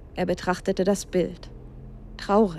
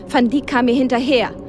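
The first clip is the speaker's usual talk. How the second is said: ordinary delivery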